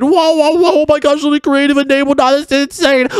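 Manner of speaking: high-pitched, gushing amazement; amazed. Acted. Player suddenly gets creative powers, amazed. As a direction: let the wows soar up high.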